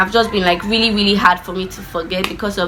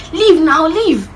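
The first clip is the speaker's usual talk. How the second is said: higher, louder mock brother command; big brother shooing her off, imitated; annoyed order; 7 semitones higher, 4 dB louder, much slower than usual. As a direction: bark it out like a fed-up big brother.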